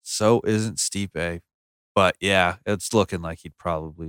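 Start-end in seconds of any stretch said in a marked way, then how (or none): 0.0-1.4 very slow, stretched words; drawn-out repeat. Real, not acted. Insisting the champ is a monster too, very slow. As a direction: drag the words out. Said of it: "repeats slowly".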